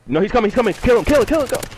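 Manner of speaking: high, loud frantic shouting; frantic yell. Real, not acted. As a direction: scream at the teammate to finish him. Panicked urging to finish enemy.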